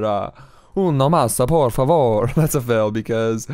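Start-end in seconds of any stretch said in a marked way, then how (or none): 0.7-2.3 loud, hammed-up Italian accent on Spanish; failed Italian accent. Shown as a demonstration. Gamer trying Spanish with an Italian accent, hammed up. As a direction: put on a bad Italian accent, punchy. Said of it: "Trying to do an Italian accent".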